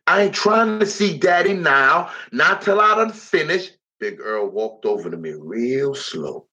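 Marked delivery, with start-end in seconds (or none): 0.1-3.8 screamed refusal. Acted. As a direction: scream it at him, refusing to hear. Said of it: "I screamed".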